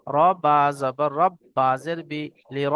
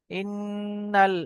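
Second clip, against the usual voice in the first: Quran recitation; 4 semitones higher, 3 dB quieter, much slower than usual. Shown as a demonstration. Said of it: "teacher recites the verse".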